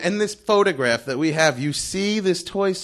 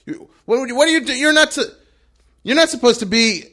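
halting, higher, louder objection; doubtful guard restarting the sentence, loudly; stumbling challenge; 4 semitones higher, 6 dB louder, faster than usual. Retold or imitated. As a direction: confront them while tripping over your own words. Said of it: "the fact that he stumbled there".

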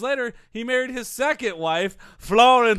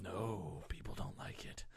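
whisper; 12 semitones lower, 22 dB quieter than usual. Real, not acted. Deadpan whispered aside.

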